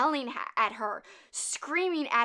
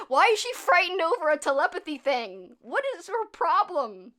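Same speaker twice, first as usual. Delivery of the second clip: loud exasperated mock yell, higher; 6 semitones higher, 7 dB louder than usual. Ventriloquist yelling at girlfriend about telepathy, loud mock outrage. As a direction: shout it in disbelief. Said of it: "he's, like, yelling".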